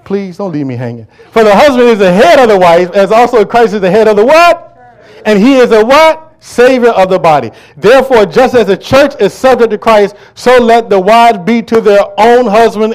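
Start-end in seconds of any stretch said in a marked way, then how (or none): 1.3-4.3 thundering sermon read; preaching at full force. Real, not acted. Preacher building to climax. 4.3-4.8 high shouted call; high call-and-response shout. Real, not acted. Call to congregation. 5.2-12.9 thundering sermon read; preaching at full force. Real, not acted. Preacher building to climax.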